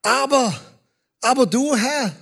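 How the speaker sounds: Aber said high and loud; emphatic Aber. Shown as a demonstration. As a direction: punch Aber du with force. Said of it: "Jetzt sind wir bei dem".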